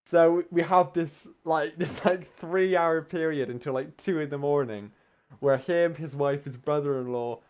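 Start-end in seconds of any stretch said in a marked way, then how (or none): none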